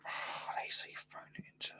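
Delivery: barely audible, breath-only whisper; dismayed whisper. Real, not acted. Dismayed at viewer's suggestion.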